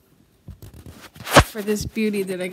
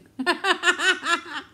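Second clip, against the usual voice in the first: loud laugh; 11 semitones higher, 8 dB louder than usual. Real, not acted. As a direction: burst of high laughter. Laughs at grandson's quote.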